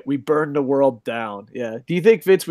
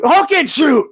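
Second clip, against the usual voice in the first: repeating a catchphrase in a loud, much higher hype yell; catchphrase shout; 12 semitones higher, 5 dB louder, slower than usual. Acted. Podcast host yells a fan's wrestling catchphrase for the show, hype shout.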